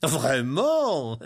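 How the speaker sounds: teasing, drawn-out question; playful sheriff. Acted. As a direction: toy with your victim in mock surprise. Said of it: "demanda le shérif joueur".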